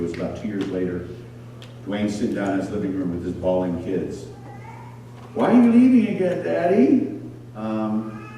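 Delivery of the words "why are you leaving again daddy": put-on child's voice. Bawling kids ask their father.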